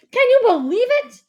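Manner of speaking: very high, loud, thrilled shout. A narrator's reading. Dad thrilled by the free meals, shouting. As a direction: shout it in amazed delight. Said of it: "my dad shouted".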